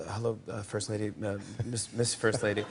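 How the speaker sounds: softly mumbled practice greeting, unsure of the title; quiet nervous rehearsal. Retold or imitated. Nervous guest rehearsing his greeting to the First Lady, softly under his breath. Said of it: "practicing, like".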